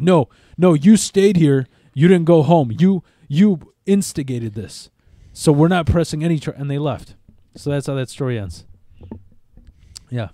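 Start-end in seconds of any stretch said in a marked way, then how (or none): 0.0-6.5 much louder, insistent cop voice; firm police refusal. Retold or imitated. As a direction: shut him down like a cop who's done listening. Cops dismissing him, retold.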